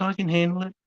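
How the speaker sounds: high-pitched imitation of a young woman; female lieutenant. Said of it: "And she's like".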